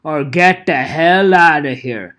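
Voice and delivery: loud, slow mock demand; hammed-up influencer greed; greedy demand. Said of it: "they go like".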